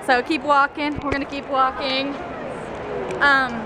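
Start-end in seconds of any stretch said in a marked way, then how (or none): none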